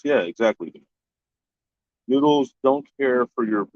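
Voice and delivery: usual speaking voice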